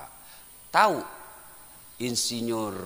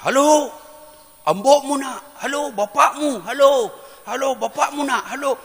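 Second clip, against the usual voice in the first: high, raised-voice imitation of a phone caller; mock phone shouting; 12 semitones higher, 7 dB louder than usual. Imitating villager yelling into a phone for his child, mock shouting. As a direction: call out repeatedly as if the line is dead. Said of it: "Baru dia teriak".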